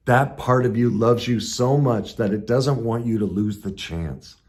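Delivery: ordinary delivery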